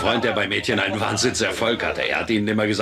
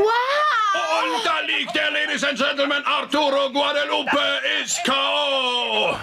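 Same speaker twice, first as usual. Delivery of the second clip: high shouted ring announcer call; roaring fight commentator; boxing announcer; slower than usual. Acted. Mock boxing announcer calls knockout.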